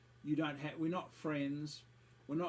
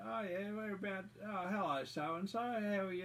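hammed-up cheery voice, pitched higher; mock chirpy greeter; 6 semitones higher than usual. AA member greeting him, mocked as chirpy and higher. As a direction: mimic a fake-friendly greeting at the meeting.

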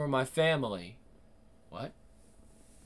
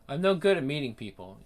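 ordinary talk

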